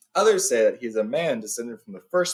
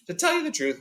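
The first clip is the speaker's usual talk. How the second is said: put-on much higher, polite old wizard; lightly voiced wizard. A narrator's reading. Gandalf explaining himself to Beorn, a little sheepish. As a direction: ease into an awkward confession.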